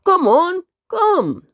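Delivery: high, loud eager dog call; barking dog. Acted. First dog barking at the second dog. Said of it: "barked the first dog".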